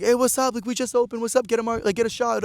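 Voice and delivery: high-pitched imitation of eager bar staff; mock upbeat greeting. Bar staff greet a customer walking in, mock upbeat.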